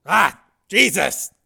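high, loud, gasping pain; wounded man in pain. Acted. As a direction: cry out through the agony.

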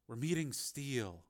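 softly spoken confession; quiet reveal. A narrator's reading. Reporter finally tells cameraman whom they're meeting, quiet reveal. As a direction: let the secret out quietly.

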